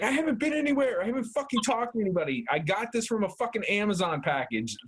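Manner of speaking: high, hurried protest in mild panic; retold freak-out. Man recounting his temperature scare to a friend, panicky. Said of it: "I lost my shit".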